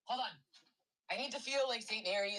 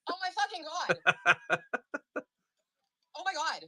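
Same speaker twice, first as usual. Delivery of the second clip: laughing disbelief; 8 semitones higher, 6 dB louder than usual. Real, not acted. Still reacting to the pull.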